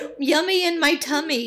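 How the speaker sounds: mock cutesy reply; sing-song mock answer. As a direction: play it cute and silly.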